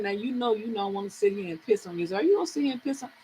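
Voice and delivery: low, hushed self-scolding; talking to herself. Retold or imitated. Woman privately warning herself not to sit there, hushed self-talk. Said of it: "I had to talk to myself".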